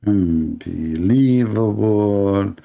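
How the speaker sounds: very slow, stretched-out unbelievable; drawn-out disbelief. Acted. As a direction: drag it out slowly in mock disbelief.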